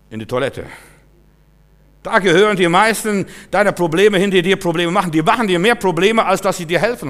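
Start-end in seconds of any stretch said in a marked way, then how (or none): 2.0-7.1 loud, high, insistent delivery; forceful preaching. Real, not acted. Preacher blames what causes more problems, forceful preaching. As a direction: hammer the point home.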